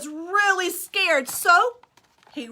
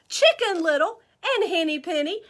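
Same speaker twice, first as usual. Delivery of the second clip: plain reading voice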